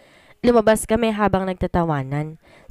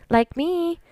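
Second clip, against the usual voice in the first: playful, higher and full of herself; vain tease; 10 semitones higher than usual. A narrator's reading. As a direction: toss off a cheeky brag about yourself. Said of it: "I said and flipped my hair".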